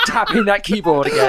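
high amused laughing remark. Real, not acted. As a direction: get it out while cracking up. Joking amid group laughter.

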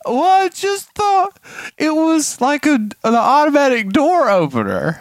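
put-on high-pitched childlike excuse; tearful little daughter. Daughter excusing herself to dad, small whiny voice. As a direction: imitate a small girl making excuses. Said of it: "She said".

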